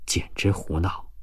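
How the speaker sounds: low, quiet grumble of disapproval; softly spoken. A narrator's reading. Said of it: "轻声地说了一句". (轻声嘟囔)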